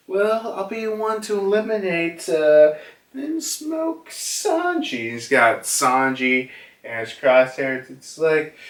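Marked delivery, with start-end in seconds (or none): 0.1-5.1 hammed-up sneer as he takes aim; smug villain. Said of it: "He's like".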